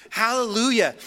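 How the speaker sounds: loud hallelujah. Acted. Elders and creatures worshipping before the throne, loud praise. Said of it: "fall down and worship God ... saying".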